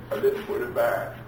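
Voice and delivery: soft, small answer; meek child reply. Acted. Child repeating Daddy's order to the parent, meek child reply. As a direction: play a sheepish child owning up.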